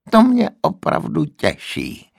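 put-on wheezing old man. A narrator's reading. The old man wheezes sarcastically at the nurse. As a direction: wheeze it out dryly. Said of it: "zasýpal stařec".

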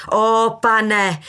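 loud, declaimed prayer; mother's theatrical invocation; declaiming. A narrator's reading. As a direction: cry out to the Lord with oratorical force. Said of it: "deklamovala matka".

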